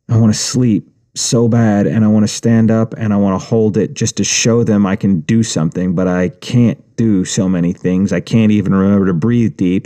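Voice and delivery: ordinary delivery, nothing marked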